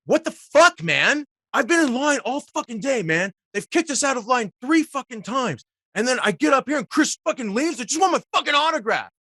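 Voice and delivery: high, angry ranting yell, speeding up to a shout; retold screaming. Fan in line screaming at the actor after Chris leaves, high angry rant. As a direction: vent the fan's fury at the wait. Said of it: "he just kept screaming at me".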